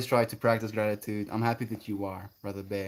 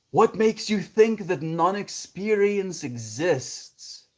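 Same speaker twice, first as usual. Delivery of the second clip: slow high musing; 7 semitones higher, 4 dB louder, slower than usual. Real, not acted.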